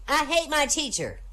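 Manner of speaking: high-pitched mock child whine; little monster child. Monster child complaining to its mother, high child voice. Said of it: "Little monster child".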